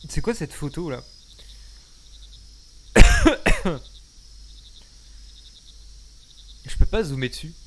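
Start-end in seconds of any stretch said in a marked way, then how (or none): 2.8-4.8 loud choking cough; coughing fit. Real, not acted. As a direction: cough it out hard. Still choking after a drink.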